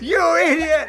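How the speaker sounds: put-on shaking the kid while yelling at him; mock furious yell. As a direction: snap at him in fake fury.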